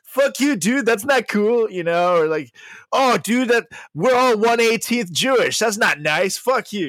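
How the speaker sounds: a hint of an offended dude; mock offended guy. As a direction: playfully act out taking offense. Imitating offended listener.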